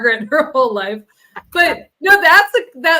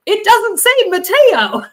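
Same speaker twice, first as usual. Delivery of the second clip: high, loud, drawn-out mock disbelief; incredulous retelling, stretched out and high; retold indignant protest; 8 semitones higher, 5 dB louder, slower than usual. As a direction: protest it slowly, pitched up and louder. Retelling his indignant reply.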